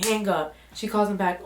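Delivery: normal talking voice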